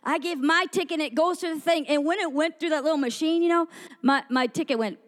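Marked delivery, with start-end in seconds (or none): none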